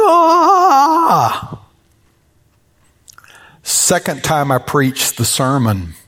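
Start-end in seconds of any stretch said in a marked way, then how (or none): 0.1-1.6 high loud falsetto wail; a hammed-up tearful cry; mock high wail. Preacher acts the widow's tearful cry, high mock wail.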